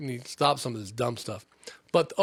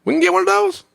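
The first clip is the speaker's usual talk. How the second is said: much higher and louder mock eager voice; mock eager shopper; 9 dB louder than usual. Shoppers wanting jetpacks from Amazon, excited squeal. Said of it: "People are like, Pfft".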